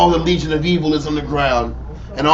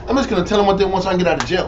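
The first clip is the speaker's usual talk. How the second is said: rushed inner voice; 3 semitones higher, much faster than usual. Retold or imitated. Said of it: "just said".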